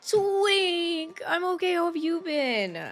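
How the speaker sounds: excited. Real, not acted. Excited greeting to returning friend.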